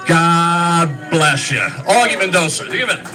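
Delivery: loud, drawn-out blessing, then the name called out; rousing toast. Real, not acted. Roaster closing his speech with a blessing for his friend, rousing toast. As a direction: stretch the blessing wide and announce the honoree.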